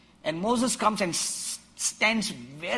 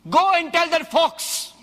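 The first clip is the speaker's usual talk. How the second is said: higher, louder bold command; defiant command; 11 semitones higher, 6 dB louder than usual. Acted. Jesus sending word to Herod, higher and louder in defiance. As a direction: fling it back at the king.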